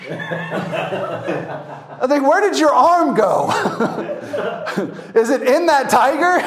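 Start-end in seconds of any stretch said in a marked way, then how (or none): 2.3-6.4 much higher, cracking up between questions; laughing joke. Retold or imitated. Tourist joking to the one-armed handler, laughing. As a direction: tease through bursts of laughter. Joking about missing arm.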